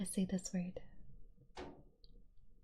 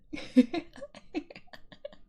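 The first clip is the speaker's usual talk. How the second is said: high laugh; 11 semitones higher, 4 dB louder than usual. Real, not acted. Laugh after moose joke.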